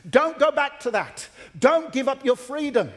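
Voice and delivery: high, forceful preaching rebuttal; all guns blazing. Acted. Preacher voicing Paul fighting legalism, all guns blazing. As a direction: fire it out as an urgent warning. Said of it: "Paul kind of goes into that all guns blazing".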